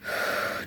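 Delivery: long, soft, audible exhale; deep breath out. A narrator's reading. Mother showing her son how to breathe, long exhale. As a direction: blow the breath out slowly.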